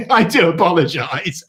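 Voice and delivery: giggling through an apology; laughing apology. Real, not acted. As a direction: laugh while saying sorry. Laughs at crude remark.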